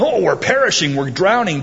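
high, loud mock panic of drowning men; panicked cry. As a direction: cry out as if the boat is sinking. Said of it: "why are these guys saying".

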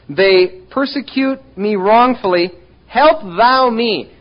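fervent scripture reading. Acted. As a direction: plead the verse slowly. Reads scripture with fervor.

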